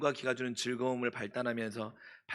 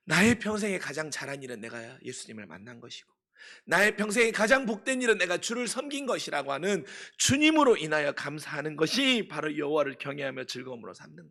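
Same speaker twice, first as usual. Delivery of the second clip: loud, higher impassioned preaching; fervent sermon climax, voice ringing high and loud; preaching at full force; 4 semitones higher, 11 dB louder than usual. Acted. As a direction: proclaim it to the whole hall, rising in fervour.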